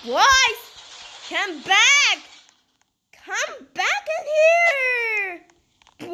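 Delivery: loud, drawn-out high call. Pretend. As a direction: wail after them to come back, stretching the words. Calling after departing boys.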